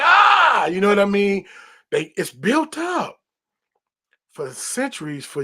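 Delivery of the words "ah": high loud burst of release; sudden yell. Retold or imitated. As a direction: let out a short loud shout. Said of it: "they be like".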